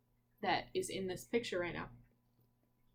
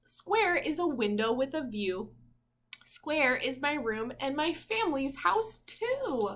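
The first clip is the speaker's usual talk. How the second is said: bright, louder picture-book reading voice; reading aloud; 4 semitones higher, 5 dB louder than usual. Acted. Parent reading a picture book to little Zeke, in a rhythmic storybook voice. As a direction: read each rhyme out like a storybook page.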